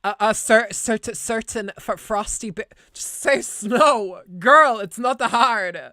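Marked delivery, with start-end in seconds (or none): 2.9-5.8 loud, slow exasperated scolding of herself; self-scolding. Real, not acted. Streamer scolds herself for fumbling a name, loud and exasperated. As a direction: drag it out, fed up with herself. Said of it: "scolding herself over her wording".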